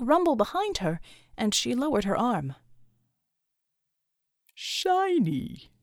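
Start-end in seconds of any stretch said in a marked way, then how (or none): 4.5-5.7 high, curious one-word offer; character voice. Acted. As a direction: offer it lightly, higher. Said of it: "he offered".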